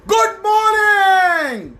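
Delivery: a high, slow, drawn-out shouted good morning. Retold or imitated. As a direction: shout it to set the bar for him. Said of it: "मैंने जोर से चिल्लाया". (प्रशिक्षक का ऊँचा, लंबा खिंचा हुआ चिल्लाकर गुड मॉर्निंग)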